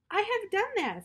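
a high, bright little toad voice; lightly voiced Toad. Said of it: "said Toad".

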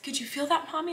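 put-on child's voice. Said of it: "he said".

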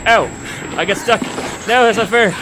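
panicked loud protest; being chased. Real, not acted. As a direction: yell in frantic alarm. Chased, stuck in game.

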